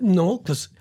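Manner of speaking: usual speaking voice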